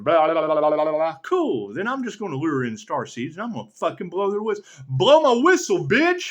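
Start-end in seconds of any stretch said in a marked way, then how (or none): 0.0-1.2 dismissive blah. Real, not acted. Dismissive list. 4.9-6.3 louder, higher and brash; cocky yelled jab; defiant shout. Real, not acted. Defiant outburst.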